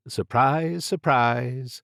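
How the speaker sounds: dry mock surprise; wry narrator aside; sarcastic. A narrator's reading. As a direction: roll your eyes on surprise, surprise.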